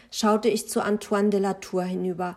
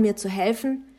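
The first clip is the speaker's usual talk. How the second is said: usual speaking voice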